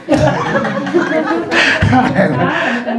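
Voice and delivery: real laugh at his own story; hearty laugh. Real, not acted. Pastor laughing at the member's excuse, real laugh. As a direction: let the laugh roll out.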